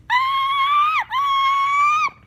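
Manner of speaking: very high loud screech; vulture screech. Pretend. Child playing vulture, high screech.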